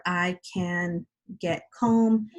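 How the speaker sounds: put-on slow, clear model sentence for young writers; slow example sentence. Shown as a demonstration. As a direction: read the example slowly so kids can copy it.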